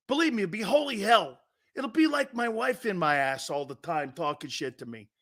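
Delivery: ordinary talk, nothing marked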